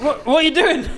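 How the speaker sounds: laughing disbelief. Real, not acted. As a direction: squeal it out laughing. DM reacting laughing.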